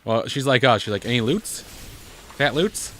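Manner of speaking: ordinary delivery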